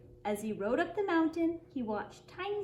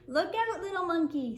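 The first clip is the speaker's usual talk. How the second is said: mild yell; 4 semitones higher than usual. A narrator's reading. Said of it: "someone yelled from the crowd".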